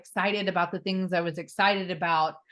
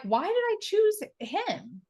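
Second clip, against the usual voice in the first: baffled; slower than usual. Retold or imitated. Baffled question to herself.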